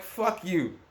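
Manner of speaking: high-pitched furious comeback; angry retort. Retold or imitated. To the man threatening him, a high furious retort. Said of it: "I'm like".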